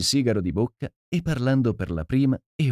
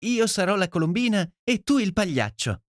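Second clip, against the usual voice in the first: much higher eager voice; delighted girl; 11 semitones higher than usual. A narrator's reading. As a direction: claim the role gleefully. Ruby still delighted.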